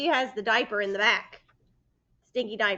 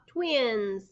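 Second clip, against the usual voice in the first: ordinary delivery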